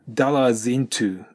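slow, the s of "dollars" running into "into"; linked dollars into. Shown as a demonstration. Teacher showing the s linking slowly. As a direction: carry the s straight into "into". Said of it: "dollarsのSとinがくっついて".

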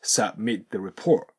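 "submit the report" with the T of submit dropped; slow demo of "submit the report", T swallowed before the; silent T. Shown as a demonstration. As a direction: let the T in submit vanish into "the". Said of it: "Tの音が消えて".

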